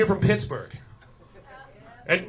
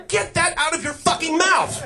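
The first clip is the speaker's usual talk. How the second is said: parent scolding; 10 semitones higher than usual. Retold or imitated. Parent scolding a toddler, mock-yelled and high. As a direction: snap it like an angry mom. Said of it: "The first three things I learned how to repeat".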